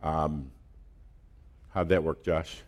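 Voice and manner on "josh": ordinary delivery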